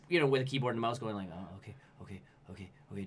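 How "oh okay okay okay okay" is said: very soft, wary murmur; nervous self-reassurance. Acted. Player creeping through Resident Evil at the keyboard, nervous soft murmur. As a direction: talk yourself round the corner under your breath.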